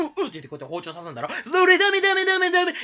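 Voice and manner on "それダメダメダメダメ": loud, high no-no-no; laughing protest. Real, not acted. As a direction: cut it off loudly, half laughing. Jokingly stopping an imitation. (笑いながら大声で止める)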